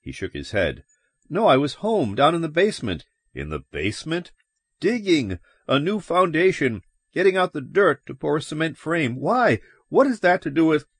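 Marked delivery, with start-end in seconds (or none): 1.3-3.1 pitched high, a touch agitated; agitated explaining. Acted. Man telling police where he was, agitated. 3.3-4.3 low, soft, probing question; questioning cop. Acted. Cop questioning a suspect about the basement, low and probing. 4.8-10.9 high-pitched, worked-up explanation; agitated explaining. Acted. Man explaining his digging to police, agitated.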